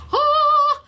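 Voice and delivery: high, loud squeal of delight, blurted out. Real, not acted. Crafter squeals with joy at a sweet stamp sentiment. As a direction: let out a sudden excited shriek.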